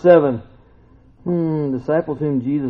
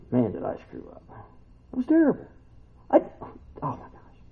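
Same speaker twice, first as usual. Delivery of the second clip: softer, sighing self-reproach; regretful inner voice; 5 semitones higher, 8 dB quieter, faster than usual. Retold or imitated. Peter brooding in the boat over his denial, soft regret. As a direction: groan to yourself over a mistake.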